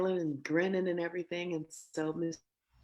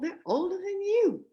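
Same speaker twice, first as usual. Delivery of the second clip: put-on incredulous young self; 14 semitones higher, 5 dB louder than usual. Young self teasing dad on phone.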